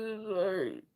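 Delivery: barely getting the word out through tears; tearful apology. Real, not acted. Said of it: "I don't know why I'm crying".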